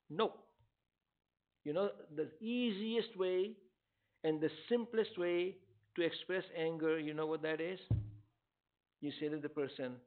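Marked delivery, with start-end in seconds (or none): none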